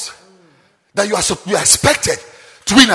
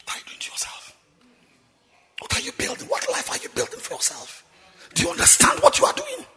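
hushed probing questions; 12 semitones higher, 16 dB quieter, faster than usual. Real, not acted.

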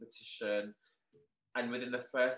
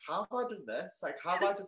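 amazed disbelief; 7 semitones higher, faster than usual. Retold or imitated. Disbelief at trending worldwide.

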